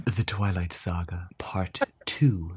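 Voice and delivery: breathy whispered movie title; sultry whisper. Pretend.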